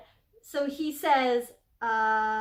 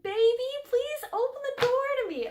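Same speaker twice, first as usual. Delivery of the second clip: much higher and louder, a begging suitor at the door; pleading lover; 11 semitones higher, 4 dB louder than usual. Acted. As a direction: plead like a lovesick boy.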